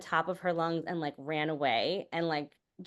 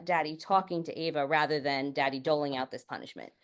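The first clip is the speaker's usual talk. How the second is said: usual speaking voice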